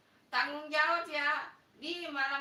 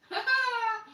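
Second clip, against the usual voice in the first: high laugh; 8 semitones higher, 7 dB louder than usual. Real, not acted. Laughs at a comment.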